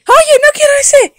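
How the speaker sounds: high, loud, indignant little dog; a toy dog yelping a complaint; squeaky dog voice. Acted. Toy dog protests its new name, high and loud.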